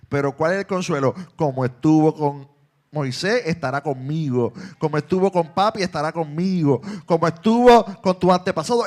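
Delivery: loud, chanting sermon refrain; full-force preaching. Acted. Pastor rallying his congregation with a loud chanted refrain.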